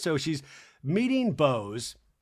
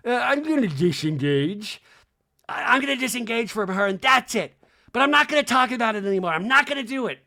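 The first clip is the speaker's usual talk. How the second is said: mock disengage; 5 semitones higher, 4 dB louder than usual. Mocking repeated disengage line.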